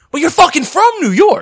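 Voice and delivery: high, loud mock protest; retold incredulous protest. Host answering his New Yorker girlfriend, high incredulous protest. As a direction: exclaim it in cartoonish disbelief. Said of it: "I'm just like".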